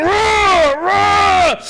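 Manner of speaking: high, loud mock roar; orcs roaring. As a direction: shout roar like a hyped orc. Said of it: "like, roaring".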